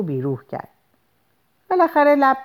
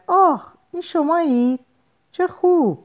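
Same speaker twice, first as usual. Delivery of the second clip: high-pitched delighted greeting; joyful cry; 13 semitones higher, 3 dB louder than usual. A narrator's reading. Caller imagines her welcoming him on the phone, joyful cry. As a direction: exclaim with happy surprise, pitched right up. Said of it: "انتظار فریاد شادی او".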